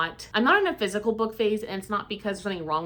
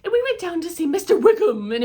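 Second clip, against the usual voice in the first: exaggerated thick Cockney impression, much higher and louder; thick Cockney accent; 9 semitones higher, 8 dB louder than usual. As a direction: overdo the heavy Cockney narrator mockingly. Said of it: "the accent was very thick and heavy".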